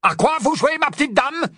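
high-pitched, indignant question; outraged challenge. Acted. Snatcher challenges the witch who attacked, outraged. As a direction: square up to her, voice pushed high.

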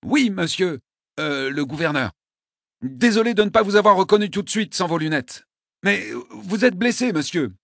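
lightly voiced young soldier, apologetic; a hint of a flustered young lieutenant. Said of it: "la voix était jeune".

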